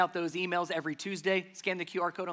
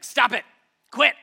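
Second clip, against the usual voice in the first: loud, short bursts, higher voice; sharp hushing command; 4 semitones higher, 9 dB louder than usual. Acted. Jesus silencing the storm, sharply commanding. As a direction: cut off the noise with forceful commands. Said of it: "He literally hushed the winds".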